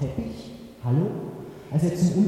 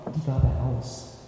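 plain reading voice

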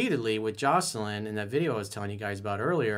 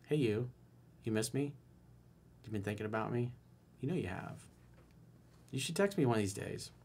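soft flirty line; 8 dB quieter than usual. Shown as a demonstration. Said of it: "you're like".

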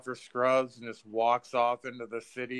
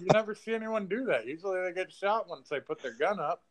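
mock amazement; 8 semitones higher, 6 dB louder, faster than usual. His own amazed reaction.

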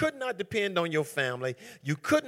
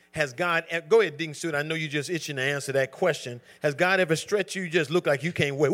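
ordinary talk, nothing marked

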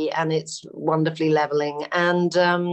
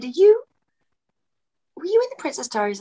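excited fan; 9 semitones higher, faster than usual. Retold or imitated. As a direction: imitate a starstruck fan as she blurts out the question. Fans recognising her in the street.

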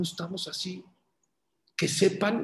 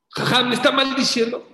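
startled outrage; 6 semitones higher, 5 dB louder than usual. Retold or imitated. Man startled, half laughing.

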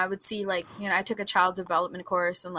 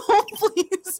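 much higher, loud laughing no please; 6 dB louder than usual. Real, not acted. Laughing as she begs friends not to use the thumbnail. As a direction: beg through real laughter.

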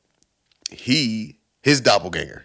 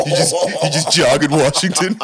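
mocking stranger; 6 semitones higher, 5 dB louder than usual. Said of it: "It's like".

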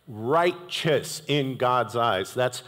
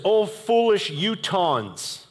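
higher, slow, mocking "Oh, foolish Utahns" with a laugh; sarcastic rebuke; 6 semitones higher, much slower than usual. Paul scolding the Galatian churches, sarcastic and amused. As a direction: scold them with a grin. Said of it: "he's got a little attitude".

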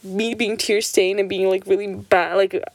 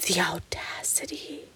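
appalled whisper; 11 dB quieter than usual. Retold or imitated. Watching cousins talk back to mom, aghast whisper. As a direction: whisper it in mock outrage.

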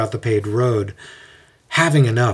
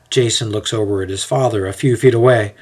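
ordinary delivery

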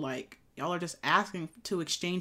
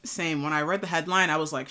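ordinary talk, nothing marked

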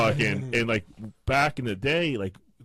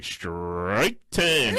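mock announcer; 3 semitones lower than usual. Friend mock-announcing his miss.

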